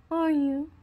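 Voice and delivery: high, loud teasing jab; mocking taunt. Goading the pup to cry, high and mocking. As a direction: press the taunt, pitched right up.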